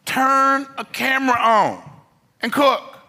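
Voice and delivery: high, loud preacher's command; urgent exhortation. Acted. Preacher urging his audience to act, loud and slow. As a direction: drive it home, slow and forceful.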